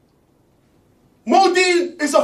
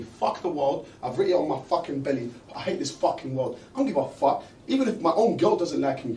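low, heavy ranting voice; brooding angry rant; 13 semitones lower, 9 dB quieter, faster than usual. Acted.

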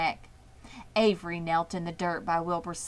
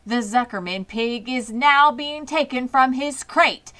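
loud, higher booming voice; booming announcer; 6 semitones higher, 9 dB louder than usual. A narrator's reading. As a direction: boom across the fairground. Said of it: "boomed the voice of the loudspeaker".

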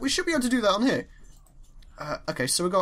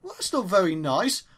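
ordinary talk, nothing marked

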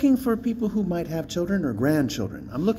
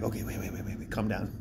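hushed unvoiced self-calming whisper, much softer; whispering to himself; 6 semitones lower, 10 dB quieter, faster than usual. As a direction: whisper to yourself to calm down. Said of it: "I realize I'm getting overly excited".

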